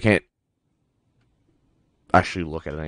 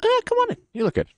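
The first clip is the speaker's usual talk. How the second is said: high welcoming voice; faster than usual. Pretend.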